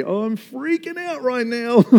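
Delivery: half-joking panic; mock freaking out. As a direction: ham up being flustered. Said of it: "go to someone and say".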